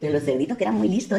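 ordinary delivery, nothing marked

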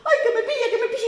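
very high, laughing panicky squeal; high laughing piglet fleeing the wolf; panicked little pig. A narrator's reading. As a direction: squeal in mock fright.

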